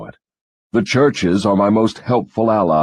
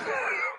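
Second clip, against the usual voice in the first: short smug laugh; gloating chuckle; 12 dB quieter than usual. Acted. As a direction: chuckle with glee. Devil gloats at accusation.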